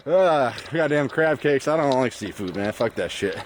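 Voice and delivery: usual speaking voice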